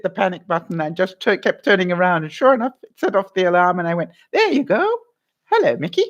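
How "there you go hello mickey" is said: high, bright announcer voice as the car is found; hammed-up cheerful greeting; mock announcement. To the listeners, retelling finding her car, a mock cheery voice. Mimics phone app speaking.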